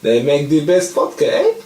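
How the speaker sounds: lightly put-on German accent, musing to Hans; a hint of a German general in wartime; mock German officer.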